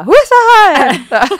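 sudden high, loud yelp of protest; pained outburst. Retold or imitated. New mother mimics being pricked by a stitch, pained yelp. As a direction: cry out high and drawn-out, as if jabbed.

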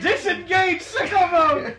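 loud, high battle call; excited call. Acted. Player calling out the combat move, excited. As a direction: shout it like a triumphant combat move.

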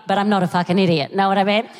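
ordinary talk, nothing marked

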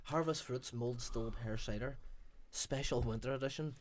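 lightly voiced ad read; label read. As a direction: read the label like a mild product blurb. Reads the cider label.

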